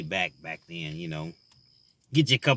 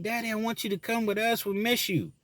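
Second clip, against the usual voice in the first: put-on high, pleading little girl's cry; imitating his daughter calling out through tears; crying child calling Daddy; 10 semitones higher, 4 dB quieter than usual. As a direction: beg like a sobbing three-year-old. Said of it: "She was like".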